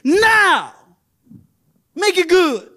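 high, loud call to the church; preaching shout. Real, not acted. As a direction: belt it out from the pulpit. Preacher calling to congregation.

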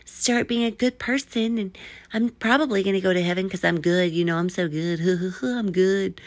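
mock self-righteous voice, starting high; put-on smug voice with a little chuckle; self-satisfied do-gooder. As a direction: parody someone pleased with their own goodness. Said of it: "you can't just like".

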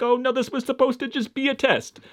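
high-pitched awkward excuse; squeaky-high imagined backpedalling; awkward mock protest. Imagined awkward conversation with therapist.

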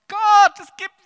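high, loud exclamation of awe; crying out to God. Retold or imitated. Telling the congregation how he cried out to God at a miracle, loud and high. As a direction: call out to God, overwhelmed.

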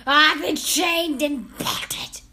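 slow, harsh, rasping witch demand; loud guttural foreign-accented witch; Grand High Witch. A narrator's reading. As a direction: rasp each word out with menace. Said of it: "shouted the Grand High Witch".

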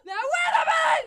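very high, loud imitation; mock shriek. Imitating a castmate's outburst, laughing mock shriek. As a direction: screech it as an exaggerated objection. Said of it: "Kaya just goes".

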